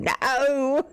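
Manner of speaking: long, high mock yell; imitated scream. Imitating Dan screaming into the microphone, mock scream. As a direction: cry out like a streamer screaming into the mic. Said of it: "screams into the microphone".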